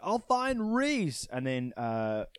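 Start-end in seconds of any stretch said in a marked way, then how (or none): 0.0-1.3 mock resolve. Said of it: "he's like".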